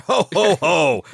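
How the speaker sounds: loud, booming Santa ho-ho; Santa Claus laugh. Acted. Santa signing off a Christmas letter, mock Santa laugh. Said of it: "where Santa goes".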